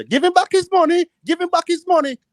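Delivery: put-on high, loud demand from an old man; a bystander old man demanding loudly from high up; indignant old man. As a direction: pipe up high and loud for the stranger. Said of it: "old man hear the conversation, was coming and say".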